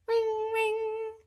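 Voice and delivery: high sing-song bell imitation; bell ring. Narrator imitating the playground bell in a high sing-song.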